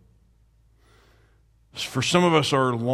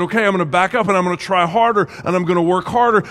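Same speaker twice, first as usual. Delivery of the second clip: rushed striving; 6 semitones higher, 8 dB louder, much faster than usual. Retold or imitated. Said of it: "and you said".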